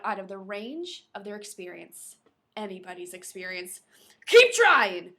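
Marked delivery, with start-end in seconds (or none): 4.3-5.1 loud, curt command; snapped order. Acted. Captain snapping at his radio man, loud. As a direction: snap it at him. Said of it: "Brennan snapped".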